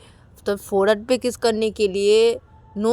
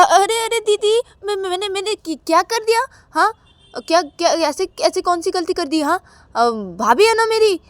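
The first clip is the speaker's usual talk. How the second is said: high stammering excuses; flustered younger brother; 7 semitones higher, 3 dB louder than usual. Acted. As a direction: fumble to justify yourself.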